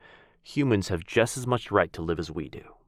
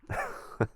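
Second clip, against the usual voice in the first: short scoffing laugh; 6 dB quieter than usual. Acted. Scoffs at rival's boasting.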